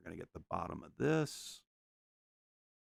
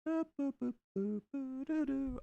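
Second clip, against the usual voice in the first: high little boops and doos; high sound effects. Acted. Painter voicing his brush strokes with high boops.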